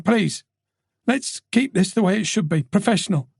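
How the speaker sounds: high, quick, embarrassed then firm; detective recovering composure; flustered Tess. Acted. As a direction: pull back to professional. Tess voiced by narrator.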